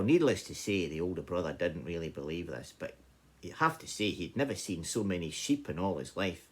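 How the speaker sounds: ordinary delivery, nothing marked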